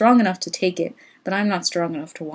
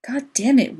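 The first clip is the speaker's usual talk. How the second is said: louder, higher burst of frustration; exasperated outburst; 6 semitones higher, 3 dB louder than usual. Acted. Man losing patience with his leaving lover, exasperated outburst. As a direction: blurt it out, fed up with her. Couple quarrelling, he explodes.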